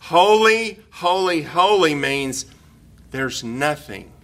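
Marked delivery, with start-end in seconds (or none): 0.0-2.5 three slow, weighty "holy"s; repeated holy. Shown as a demonstration. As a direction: lean on every "holy" in turn, the first one ringing out. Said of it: "They'd just repeat the word".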